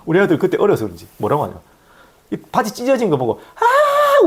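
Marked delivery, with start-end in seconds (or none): 3.6-4.3 sudden very high delighted yelp; child's laugh cry. Retold or imitated. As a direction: squeal like a giggling kid. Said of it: "웃고 있는 거야, 막".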